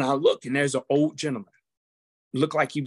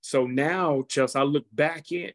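usual speaking voice

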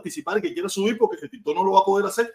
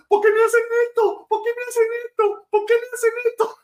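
lloriqueo agudo y fuerte, de burla; llanto burlón; 4 dB louder than usual. Retold or imitated. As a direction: imita al tipo llorando mientras lo arrestan. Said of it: "donde tú sales llorando". (lloriqueo exagerado y burlón, agudo)

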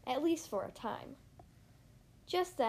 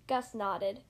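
ordinary delivery

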